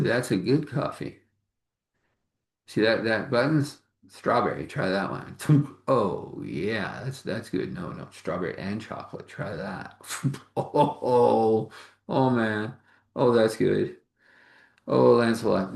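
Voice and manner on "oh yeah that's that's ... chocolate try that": ordinary talk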